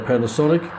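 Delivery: ordinary delivery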